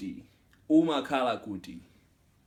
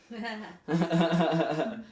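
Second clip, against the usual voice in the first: a short burst of real laughter; 7 semitones higher, 4 dB louder than usual. Real, not acted. Teacher laughing at his own example answer, real laugh. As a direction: laugh out at your own joke.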